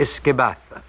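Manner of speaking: "Iskebatha" said carefully in a Gaelic-sounding voice, set apart from the rest; Gaelic word. Shown as a demonstration. Host naming the Irish drink for his listeners, Gaelic pronunciation. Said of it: "Iskebatha being Gaelic for whiskey".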